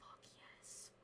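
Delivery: very soft whisper; whispered triumph. Real, not acted. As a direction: whisper a secret little victory. Private small triumph.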